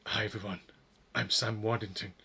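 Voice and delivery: low, soft attempt at an actor's voice; Sam Waddington. Streamer botching an actor's introduction, poor low impression. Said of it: "how to do his voice accent".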